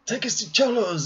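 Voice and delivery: high, pressing order to the driver; urgent request. Retold or imitated. Mary Poppins urging the taxi driver.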